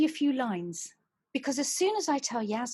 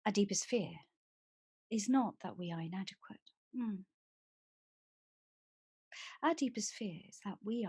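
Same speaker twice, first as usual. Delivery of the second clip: soft, hushed recital of a poem; a soothing voice reading lines to calm someone down; quiet poem reading; 6 dB quieter than usual. Acted. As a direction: read it gently, almost to yourself. Reads poem aloud.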